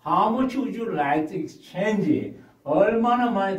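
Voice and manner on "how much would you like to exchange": slow, careful English question; teacher demo read; slow model reading. Shown as a demonstration. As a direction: read it slowly for learners. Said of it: "먼저 제가 한번 읽고".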